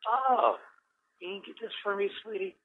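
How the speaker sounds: high, soft mock grandma voice; sweet grandmother. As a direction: imitate grandma gently asking a favour. Grandmother asks child in retold scene.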